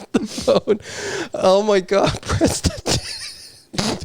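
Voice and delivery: breathless, airless, slow, dissolving into laughter; laughing whisper. Real, not acted. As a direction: let the laugh swallow the words. Laughter swallows the line.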